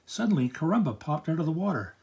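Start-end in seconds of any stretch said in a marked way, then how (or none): none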